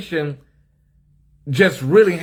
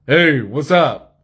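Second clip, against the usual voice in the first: slow, lowered butch greeting; mock deep voice; 2 semitones lower, 4 dB louder, slower than usual. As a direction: fake a deeper voice for a stranger. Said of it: "They hear the housekeeping lady".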